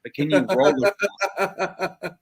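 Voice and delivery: ordinary delivery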